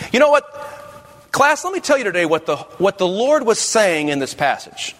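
high, emphatic classroom opener; teacher addressing a class. Shown as a demonstration. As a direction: launch an imaginary lesson with lifted pitch. Models teaching an imaginary class.

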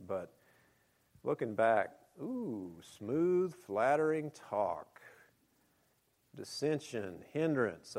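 ordinary talk, nothing marked

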